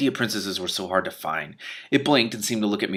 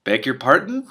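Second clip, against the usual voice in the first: puzzled dragon; 4 semitones higher, 6 dB louder, slower than usual. A narrator's reading. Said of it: "Its voice reminded me of ... wooden wind instruments".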